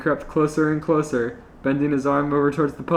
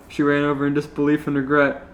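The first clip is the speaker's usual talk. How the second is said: ordinary delivery, nothing marked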